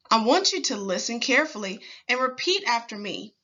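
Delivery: usual speaking voice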